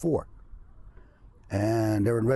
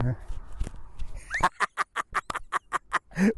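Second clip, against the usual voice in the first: breathy laugh; 7 semitones higher than usual. Real, not acted. Laughs after Milli Vanilli joke.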